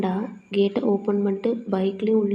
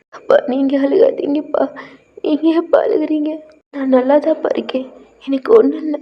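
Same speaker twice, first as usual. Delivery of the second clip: higher, louder, voice full of tears; tearful plea; 4 semitones higher, 6 dB louder than usual. Acted. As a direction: beg him to stop crying through your own tears. Tearful child comforts crying father. (கண்ணீருடன் கெஞ்சும் குரல்)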